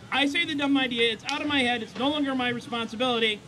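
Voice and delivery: normal talking voice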